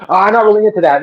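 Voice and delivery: mocking men who shrug off stick fighting, hammed-up reluctance; mock reluctant reply. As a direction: play the half-interested guy brushing it off. Mocks reluctant guys' reply.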